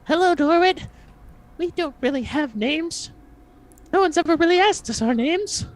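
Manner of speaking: tiny creature in a very high voice; high-pitched kobold. Acted. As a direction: answer as a squeaky little kobold. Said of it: "very high-pitched voice goes".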